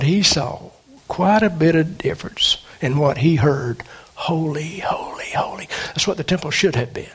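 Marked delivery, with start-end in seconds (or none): 4.1-5.7 fading to a whisper. Acted. Reverent repetition fading to whisper.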